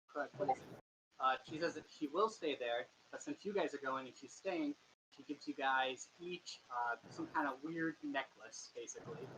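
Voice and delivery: ordinary talk, nothing marked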